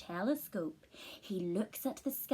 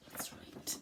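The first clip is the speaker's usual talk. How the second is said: whisper; 13 dB quieter than usual. Acted. Whispered aside.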